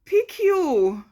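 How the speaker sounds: slow, high, loud sermon delivery; preaching. Real, not acted. Warning women against being a pick-me, preaching. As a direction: draw each word out like a preacher. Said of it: "She's preaching a whole sermon".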